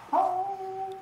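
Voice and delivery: high cheerful sing-song; excited. Real, not acted. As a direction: cheer it out, delighted. Dad cheering dog on Father's Day.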